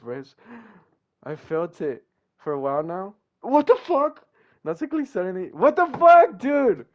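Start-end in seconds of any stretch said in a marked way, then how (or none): 3.4-6.8 high, loud outraged shouting; stunned screaming reaction; shocked yell. Real, not acted. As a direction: yell it out in real disbelief. Shocked by Natsuki's move.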